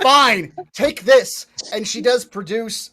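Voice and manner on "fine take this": put-on loud, impatient old witch; angry old woman. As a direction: snap at the adventurers. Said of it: "she storms out".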